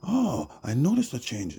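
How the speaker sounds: very high put-on voice; imitating other people. People reacting when told the queen was switched, high-pitched imitation. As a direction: mimic them in a high-pitched voice. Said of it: "they're like".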